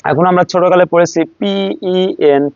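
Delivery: ordinary talk, nothing marked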